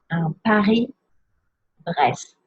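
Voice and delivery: slow "Un Paris-Brest", Paris as pa-ree, then Brest with s and t sounded; French pronunciation. Shown as a demonstration. Teacher showing which letters to pronounce, slow careful French pronunciation. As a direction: draw out "Paris-Brest", silent S in Paris, every letter in Brest. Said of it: "don't pronounce the S in Paris".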